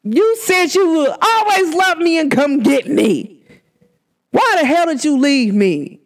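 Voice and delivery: high, drawn-out mock accusation; acted-out wounded protest; retold hurt pleading. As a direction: plead like someone left behind. Acts out pleading with lost friend.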